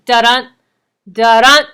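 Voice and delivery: a heartbeat beat, light DA then heavy DUN; heartbeat stress. Shown as a demonstration. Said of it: "There's an emphasis on the second".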